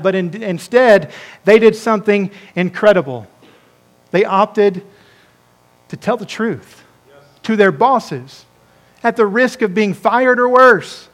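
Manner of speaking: usual speaking voice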